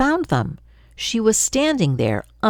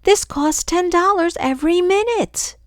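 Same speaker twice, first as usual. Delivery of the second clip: higher pitched, urgent protest; frantic protest; 12 semitones higher than usual. Acted. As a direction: plead fast against the cost. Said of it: "my sister yelled".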